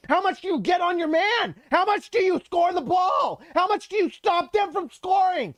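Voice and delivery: rising, shouting with excitement; hyped shouting. Real, not acted. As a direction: build it up into a yell. Hyped rant about impact.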